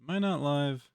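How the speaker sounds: normal talking voice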